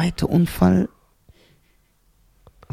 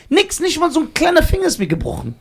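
much higher, amazed insistence; excited; 10 semitones higher than usual. Real, not acted. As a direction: marvel that nothing happened. Stressing he was unhurt.

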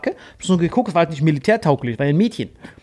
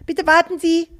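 put-on high little girl calling out; child's voice; 11 semitones higher than usual. Girl calls out to hold the lift, high child voice. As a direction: pipe it up like a small kid. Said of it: "höre ich so eine Kinderstimme".

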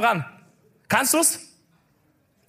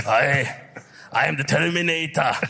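put-on low Austrian-accented action-star English; low-pitched, halting Austrian English; Arnold; 5 semitones lower than usual. Host searches for Arnold's voice on stage, low Austrian-accented impression. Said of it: "Wie redet ein Arnold?".

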